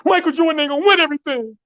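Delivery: very high, fast, fierce repeat; a teen star doubling down, pitch soaring. Retold or imitated. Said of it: "And then he said".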